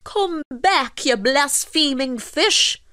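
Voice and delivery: high-pitched angry call; indignant calling out. A narrator's reading. Fisherman calls after the escaping fish, indignant. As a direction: call out indignantly, drawing out the end. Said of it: "called out Michael as he shook his fist".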